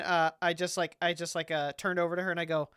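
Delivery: ordinary talk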